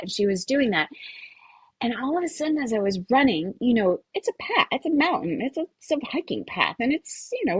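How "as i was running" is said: ordinary talk, nothing marked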